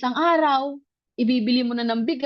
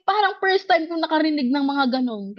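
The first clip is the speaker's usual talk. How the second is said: ordinary talk, nothing marked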